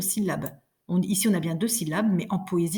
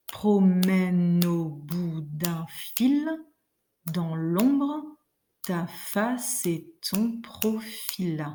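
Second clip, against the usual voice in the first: very slow, each foot spaced out, fading to a whisper; slow rhythmic recitation trailing into a whisper; slow scansion; much slower than usual. Shown as a demonstration. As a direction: count the feet slowly, ending hushed. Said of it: "si je claque un peu dans les doigts".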